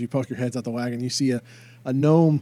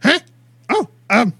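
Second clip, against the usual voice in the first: gnome voice; 12 semitones higher, 9 dB louder than usual. Acted. Gnome surprised.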